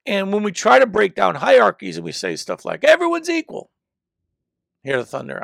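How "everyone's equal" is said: a high, louder sarcastic parroting; mocking quote. Preacher mocking people who say everyone is equal, sarcastic. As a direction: sneer it like a naive slogan.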